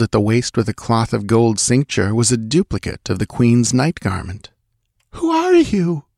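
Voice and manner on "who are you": very high, frightened demand; gasped. A narrator's reading. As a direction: gasp it out in alarm, pitched way up. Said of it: "gasped Taramis".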